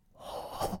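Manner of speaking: soft whispered ho, ho into an ear. Acted. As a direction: whisper it right into the boy's ear. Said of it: "hvisker mig i øret".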